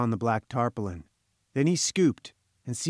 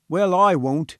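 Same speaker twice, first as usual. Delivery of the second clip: firm, louder and higher; decided refusal; 5 semitones higher, 5 dB louder, slower than usual. A narrator's reading. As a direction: refuse point-blank, with decision. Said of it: "said the head engineer with decision".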